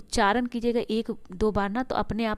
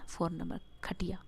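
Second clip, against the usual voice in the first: soft unvoiced whisper; teacher whispers the option number to students; whispered answer; 3 semitones lower, 11 dB quieter than usual. Shown as a demonstration. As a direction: let the answer slip out in a whisper.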